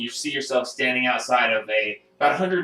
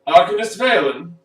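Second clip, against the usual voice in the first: loud, raised call in character; 8 semitones higher, 8 dB louder than usual. Acted. Perrin calls to Arcanist Valen, calling out loudly. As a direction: call urgently for attention, higher and louder.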